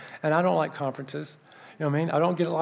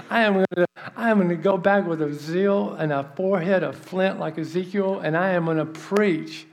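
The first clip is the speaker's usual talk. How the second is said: raised, emphatic preacher's vow; fired-up resolve; 3 semitones higher, 4 dB louder than usual. Retold or imitated. He vows to go back and preach, fired-up resolve. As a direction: declare it with mounting zeal.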